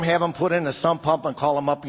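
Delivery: ordinary talk, nothing marked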